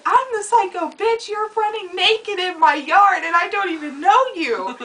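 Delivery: high, loud mock indignant yell; retold outrage. To the man who called her psycho, an indignant yell. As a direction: throw the insult back at him. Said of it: "And I was like".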